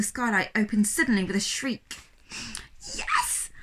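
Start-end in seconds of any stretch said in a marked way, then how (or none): 2.9-3.5 triumphant yes. A narrator's reading. Skylight finally opens.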